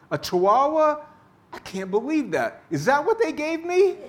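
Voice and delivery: a caught-out faker hamming up disbelief; mock shock. Blind dog owner faking outrage at the maitre d', hammed-up shock.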